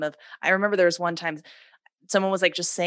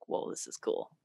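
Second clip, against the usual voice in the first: whispered awe; 14 dB quieter than usual. Retold or imitated. Retold inner reaction of awe.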